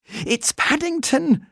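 high, slow alarmed call; urgent cry. A narrator's reading. Judy rushing upstairs to the bear in trouble, crying out in alarm. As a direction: cry the news back over your shoulder. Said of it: "cried Judy over her shoulder".

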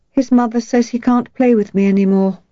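a girl telling her mother bad news; upset young girl. A narrator's reading. Upset little girl complains.

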